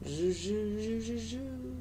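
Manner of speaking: ordinary talk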